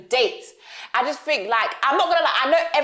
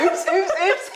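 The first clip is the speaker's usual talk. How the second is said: playful oops; 7 semitones higher, slower than usual. Real, not acted. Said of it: "that's not how we normally start".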